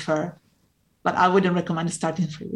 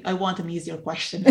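usual speaking voice

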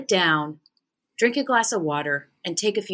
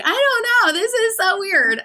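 squeaky, much higher playful confusion; high mock bafflement; 13 semitones higher, 4 dB louder than usual. To the guy she read, high and baffled. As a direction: pitch it way up, bewildered. Her own retold reply.

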